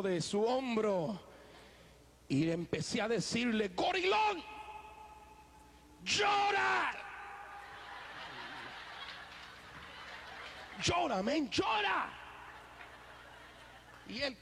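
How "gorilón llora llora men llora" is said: urgent shout. Retold or imitated. Urging his friend at the altar to weep, shouting. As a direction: push him to cry out.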